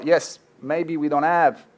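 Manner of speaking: ordinary talk, nothing marked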